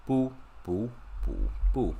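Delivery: 不 repeated with falling pitch, firmly; fourth tone. Shown as a demonstration. Teacher drilling learners, 不 in a firm fourth tone. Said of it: "fourth time".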